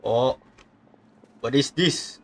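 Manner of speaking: ordinary talk, nothing marked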